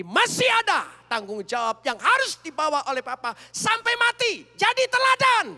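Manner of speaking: high, loud, building to a shout; daughter's rebuke. Retold or imitated. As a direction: rise into a fierce rebuke. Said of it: "Anak perempuan saya ... bicara begini".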